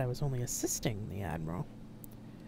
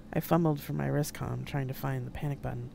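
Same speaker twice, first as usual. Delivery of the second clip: ordinary delivery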